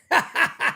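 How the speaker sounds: quick burst of real laughter; brief amused cackle; short laugh. Real, not acted. Streamer bids ejected player goodbye, laughing. Laughs after mock farewell.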